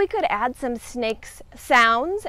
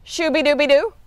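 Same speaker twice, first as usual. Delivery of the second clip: ordinary talk, nothing marked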